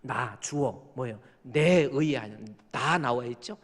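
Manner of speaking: ordinary talk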